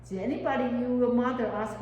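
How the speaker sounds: ordinary talk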